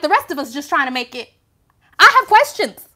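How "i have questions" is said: pitched high and loud on I have questions; I sharply raised, questions falling; exasperated. Real, not acted. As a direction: burst out with real exasperation. Exasperated emphatic repeat.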